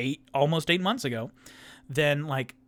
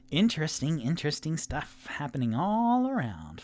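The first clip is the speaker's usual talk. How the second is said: ordinary delivery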